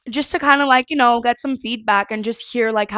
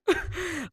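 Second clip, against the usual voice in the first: soft laugh; 7 semitones higher, 8 dB quieter than usual. Real, not acted. Laughing at herself.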